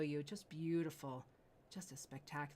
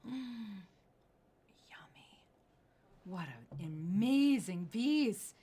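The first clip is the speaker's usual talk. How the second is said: gasp of delight; 3 semitones higher, 8 dB louder, slower than usual. Real, not acted. Host reveals jade saddle.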